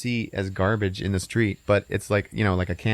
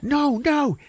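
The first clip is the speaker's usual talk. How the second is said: squeaky protest; 4 dB louder than usual. Retold or imitated. Frank protesting.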